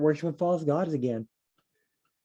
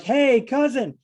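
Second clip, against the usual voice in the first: high, louder, delighted hey cousin; happy greeting; 11 semitones higher, 6 dB louder, slower than usual. Pretend. Jacob greeting his cousin Rachel at the well, high and loud. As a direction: call out, thrilled to see her.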